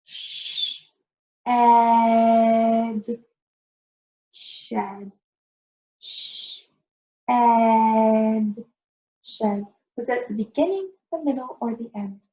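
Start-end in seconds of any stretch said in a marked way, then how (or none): 0.0-5.1 segmenting shed; sounded out. Shown as a demonstration. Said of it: "when we finger stretched it". 6.0-9.7 segmenting shed; sounded out. Shown as a demonstration. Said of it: "when we finger stretched it".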